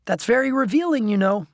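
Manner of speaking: put-on a know-it-all boy making his point; Steve's voice. A narrator's reading. Friend Steve walking to the store, knowing and eager.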